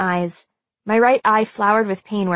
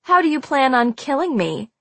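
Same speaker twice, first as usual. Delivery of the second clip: mocking, higher-pitched taunt; sneering; 6 semitones higher than usual. A narrator's reading. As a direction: taunt with contempt. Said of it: "Marcy sneered".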